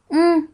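M as a closed-lip hum, "mmm"; letter sound demo. Shown as a demonstration. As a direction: sound out M with no vowel. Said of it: "diye okunur". (M'yi "mmm" diye mırılda)

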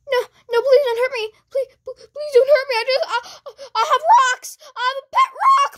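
high, frantic mock begging; panicked pleading. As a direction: beg for your life in a high voice. Victim begs not to be hurt.

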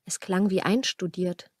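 ordinary talk

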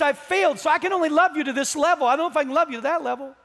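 loud, high, fast preaching voicing a pleading Peter; rapid, fervent protest from the pulpit; impassioned preaching. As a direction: pour it out urgently at full preaching force.

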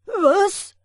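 very loud, high startled cry; frightened yelp. A narrator's reading. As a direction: jump with fright. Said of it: "heftig erschrocken". (erschrockener Aufschrei)

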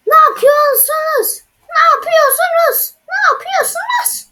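high, loud, furious cry building to a shout; outraged shriek. Acted. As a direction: shriek at them as if betrayed. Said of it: "Çok sinirlendim".

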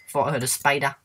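normal talking voice

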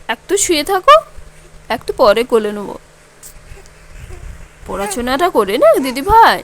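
put-on high, loud sing-song coaxing the baby; loud, lilting baby talk to the infant. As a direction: coo brightly up at the little one. Mother soothes the baby.

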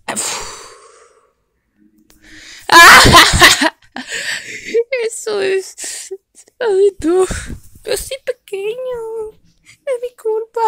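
high, sorry, half-laughing; a tiny dog excusing itself; whiny little dog. Acted. Small dog apologizes after failing the test.

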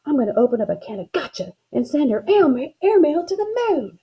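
high, punchy vow of revenge; snapped threat. A narrator's reading. Girl vowing revenge on her sister, snapped threat. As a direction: drive the threat upward in pitch. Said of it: "I snapped".

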